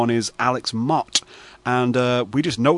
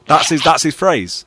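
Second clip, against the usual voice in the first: ordinary delivery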